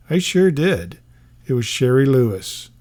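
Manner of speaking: ordinary talk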